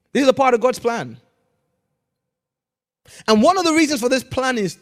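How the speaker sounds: fast, loud fervent preaching. Real, not acted. Pastor drives the point home to the church, fervent.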